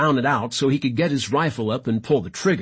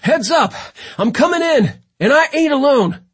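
high, loud urgent yell; screaming; 13 semitones higher, 6 dB louder than usual. A narrator's reading. London screaming a warning over the headset, urgent yell. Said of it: "London's voice came screaming".

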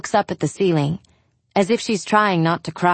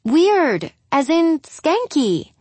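emphatic; 9 semitones higher, slower than usual. Acted. Julia spelling out the underwear.

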